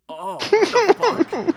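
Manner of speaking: high, loud, slow cry of disgust; shocked yelp. Acted. Guard smelling the oil, high shocked yelp. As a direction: recoil in horror at the smell.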